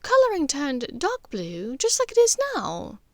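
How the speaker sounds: a hint of the cool aristocratic mother; lightly voiced Narcissa.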